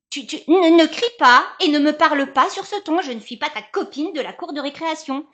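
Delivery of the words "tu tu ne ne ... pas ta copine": louder, higher, sharp telling-off; scolding. Acted. Mother scolding her son for shouting, loud and stern. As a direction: put your foot down with the child.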